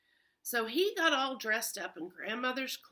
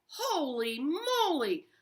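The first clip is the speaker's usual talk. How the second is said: mild mock panic; panicked wolf; 5 semitones higher, 5 dB louder than usual. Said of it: "the wolf thought".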